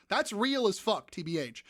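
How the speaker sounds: ordinary delivery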